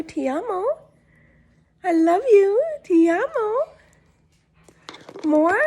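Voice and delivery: high, loud doting coo; gushing pet talk. Real, not acted. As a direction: gush over the pet with love.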